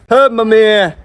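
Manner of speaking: high, drawn-out call for mum. Real, not acted. Son looking for his mother, calling out. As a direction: call out to reach someone away from you.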